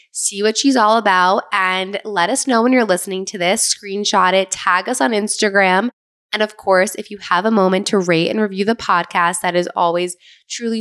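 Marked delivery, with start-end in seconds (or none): none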